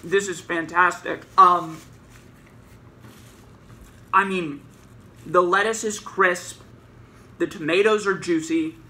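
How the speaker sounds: chewing behind fist; muffled by covered mouth; mouth full, hand over mouth. Real, not acted. Seen 2: Food at mouth, then fist covering mouth while talking throughout.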